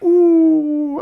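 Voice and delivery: high drawn-out "ooh" of a watching crowd; long, high imitated crowd ooh; crowd reaction. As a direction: mimic spectators wincing together. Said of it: "Everyone in the audience is like".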